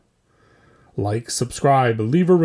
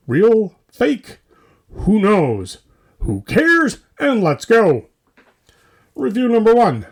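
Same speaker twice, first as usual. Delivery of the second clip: rising, louder hype-man calls; hyped up; 6 semitones higher, 6 dB louder than usual. Acted. Host hyping the listeners into the segment, excited. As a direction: pump up the crowd.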